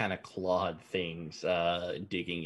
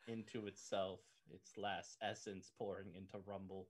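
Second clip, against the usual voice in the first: hushed, solemn storytelling; soft sad narration; 13 dB quieter than usual. Acted. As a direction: narrate the farewell gently, almost whispering. GM narrates a sad death scene.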